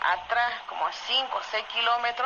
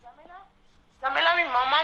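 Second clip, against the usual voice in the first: higher pleading voice, mimicking someone about to cry; retold plea, pitched up as if close to crying; imitated hoarse, near tears; 6 semitones higher than usual. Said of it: "me decía así con la voz toda ronca".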